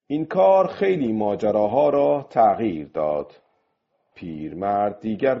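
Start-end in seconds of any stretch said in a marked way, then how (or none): none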